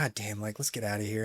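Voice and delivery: quiet self-talk. Retold or imitated. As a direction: grumble it almost under the breath. Inner voice wanting to leave.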